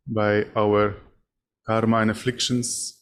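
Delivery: normal talking voice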